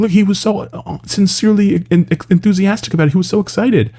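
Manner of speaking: high-pitched imitation of gushy fans; mock gushing. Mimicking fans defending how excited he was, mock gushing.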